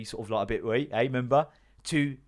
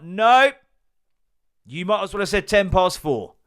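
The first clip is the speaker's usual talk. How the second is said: loud retort; 6 semitones higher, 8 dB louder than usual. Retold or imitated. Retorting to Bill's vague answer.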